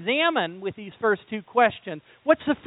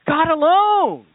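ordinary talk

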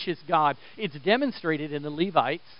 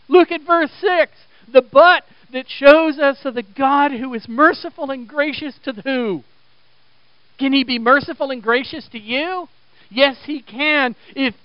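raised, emphatic pulpit delivery; high, ringing preacher's insistence; full-force preaching; 8 semitones higher, 8 dB louder than usual. Real, not acted. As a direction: press the point on the congregation.

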